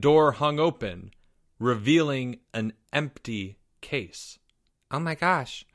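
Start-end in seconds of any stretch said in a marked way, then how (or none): 4.9-5.8 gasped. Acted. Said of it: "gasped Jeffrey".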